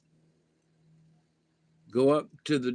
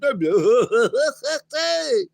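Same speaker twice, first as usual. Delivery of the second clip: high laugh; 10 semitones higher, 5 dB louder than usual. Real, not acted. After hyper talk.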